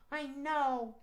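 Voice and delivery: small high cat voice, resigned; Max the cat. Acted. Cat reminded they are neighbors, high grudging cat voice. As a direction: concede reluctantly as a high puppet cat. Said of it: "but Max".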